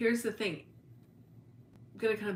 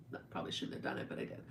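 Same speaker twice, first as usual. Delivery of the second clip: whispered confession, much softer; sheepish whisper; 8 dB quieter, much faster than usual. Real, not acted. Admitting she called her husband names, in a sheepish whisper. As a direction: owns up under her breath.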